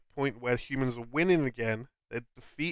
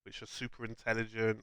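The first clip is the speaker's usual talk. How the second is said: ordinary delivery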